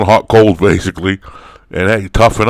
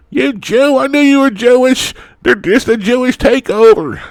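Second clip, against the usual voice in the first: mock accuser. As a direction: shriek it like a paranoid troll. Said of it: "Here we go again".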